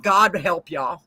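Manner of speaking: slow "God help y'all" in a drawl; southern drawl. Pretend. Slow southern blessing to the listeners. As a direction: drag it out like a country preacher. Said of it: "trying to get back to my normal self".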